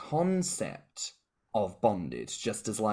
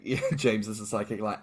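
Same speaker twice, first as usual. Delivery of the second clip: ordinary talk, nothing marked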